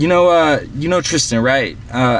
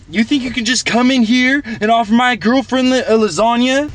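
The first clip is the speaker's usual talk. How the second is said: high-pitched enraged rant; fuming mad, voice pitched way up; freaking out; 8 semitones higher than usual. Retold or imitated. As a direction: go off at him, voice shooting up high. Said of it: "freaking out".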